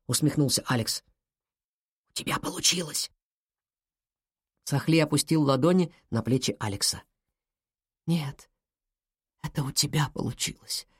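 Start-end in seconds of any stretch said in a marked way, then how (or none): 2.1-3.1 hushed, much softer congratulation; barely voiced exclamation; whisper. A narrator's reading. Hushed exchange after warning about granny. 8.1-10.9 soft, sincere murmur; hushed reply. A narrator's reading. Hushed reply to whispered congratulation.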